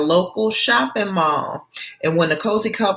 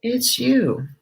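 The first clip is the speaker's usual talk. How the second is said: normal talking voice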